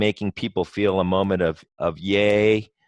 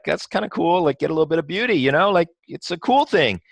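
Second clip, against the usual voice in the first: much higher, easygoing and offhand; breezy casual reply; 8 semitones higher than usual. Retold or imitated. Chairman to a nervous customer, breezy much higher voice. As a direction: keep it light and casual. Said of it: "I'm just kinda like".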